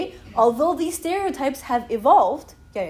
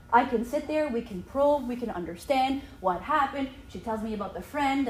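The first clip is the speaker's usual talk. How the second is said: ordinary delivery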